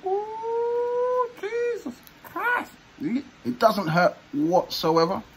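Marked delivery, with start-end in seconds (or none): 0.0-2.7 high squeal. Real, not acted. Pulling the mask off.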